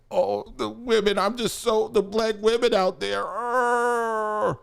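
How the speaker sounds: high-pitched fake weepy whimper; mock him with exaggerated, drawn-out fake crying; mock tearful. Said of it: "trying to conjure up fake tears".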